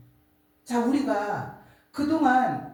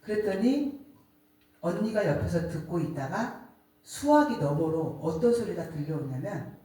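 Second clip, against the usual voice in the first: ordinary delivery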